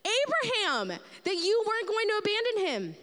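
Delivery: high, pressing accusation; incredulous challenge. Retold or imitated. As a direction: throw God's own words back at him. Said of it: "He's saying".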